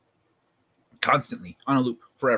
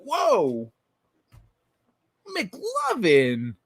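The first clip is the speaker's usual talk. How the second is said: surprised; 5 semitones higher, much slower than usual. Retold or imitated. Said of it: "the other cop is like".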